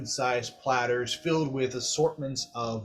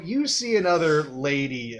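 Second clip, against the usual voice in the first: usual speaking voice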